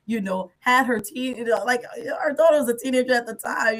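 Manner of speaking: normal talking voice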